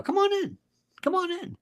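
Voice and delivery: high-pitched beckoning call; high, inviting sing-out; mock welcome. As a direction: wave them in with a lilting voice. Voicing NATO welcoming a new member.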